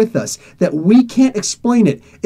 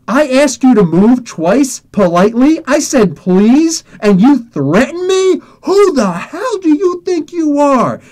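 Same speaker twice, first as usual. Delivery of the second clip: higher, slow and heavily punched indignation; retold righteous outrage; outraged confrontation; 5 semitones higher, 3 dB louder, slower than usual. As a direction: demand an answer, building to a drawn-out high finish. Acted angry confrontation with the kid.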